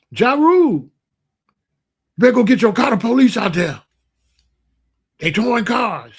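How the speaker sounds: urgent heads-up called from outside; calling out. Retold or imitated. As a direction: imitate a neighbor hollering a warning. Neighbors calling out to warn him.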